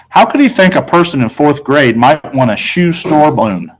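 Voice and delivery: ordinary delivery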